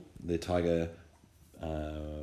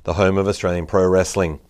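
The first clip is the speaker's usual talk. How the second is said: upbeat announcer ad voice; punchy promo read, selling it; 10 dB louder than usual. Acted. Sponsor ad read before the show.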